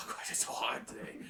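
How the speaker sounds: fast, breathy whisper to himself; whispered complaint. Retold or imitated. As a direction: mutter it under your breath, quickly. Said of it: "He's like".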